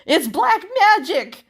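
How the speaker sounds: high, loud shriek of fright; terrified shriek. Acted. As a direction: scream it out, voice shooting up. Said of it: "he shrieked".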